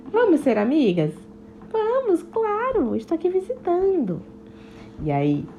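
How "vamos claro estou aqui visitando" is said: plain reading voice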